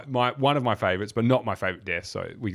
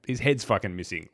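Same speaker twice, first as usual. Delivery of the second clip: normal talking voice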